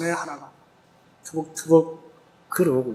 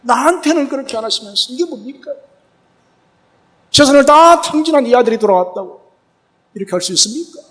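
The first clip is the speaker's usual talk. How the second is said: put-on resentful older son; 9 semitones higher, 6 dB louder than usual. Older son's complaint again.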